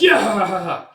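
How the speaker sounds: high, loud mock cackle; villain's cackle. Pirate hanging on to the pod, wild cackle.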